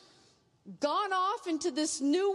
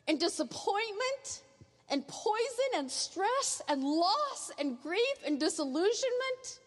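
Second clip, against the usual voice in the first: usual speaking voice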